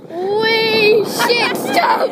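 high, loud yell rising to a shriek. Real, not acted. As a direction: shout her down as she speeds off. Yelling at sister on scooter.

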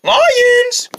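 high, searching call. Acted. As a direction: call out high for someone hiding. Calling out to hidden lions.

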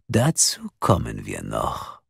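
low, slow ominous promise; sinister caller. A narrator's reading. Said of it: "sagte die Stimme".

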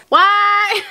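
1 surprised shout. Real, not acted. Surprised by second gloss.

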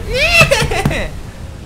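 high-pitched laughing fit; high burst of laughter. Real, not acted. Laughing at own mistake.

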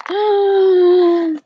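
loud, high, drawn-out gasp; dramatic gasp. Acted. Storyteller reacting to who the bear meets, shocked gasp. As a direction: gasp big for the young listeners. Reader gasps as the bears meet.

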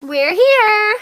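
loud, high cry of arrival; thrilled call to the crew; excited arrival shout. Acted. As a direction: announce it with a burst of joy. Arriving at the destination.

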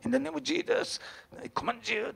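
high-pitched preacher's invocation, imitated; Thomas Muti. Showing the congregation a Kenyan pastor praying, high striking impression. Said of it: "こう、すごい声なんですね".

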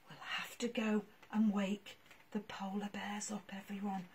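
soft whisper. Real, not acted. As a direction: hush so the bears sleep.

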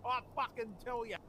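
mock Australian wildlife host; lightly voiced Aussie croc hunter, mate; Australian accent. Nature-show hunter bit, "mate.

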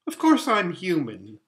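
slightly baffled insistence; mildly puzzled. A narrator's reading. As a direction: answer as if puzzled by the question. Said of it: "still a little puzzled".

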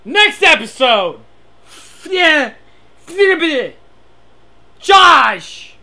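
loud hyped teaser for the next episode; mock announcer. Player closing the video, mock announcer. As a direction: shout it out like a show promo.

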